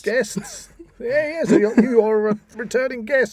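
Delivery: high, loud, giddy mad-scientist voice. Pretend. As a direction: cackle it like a mad scientist in his lab. Hamming up the returning guest.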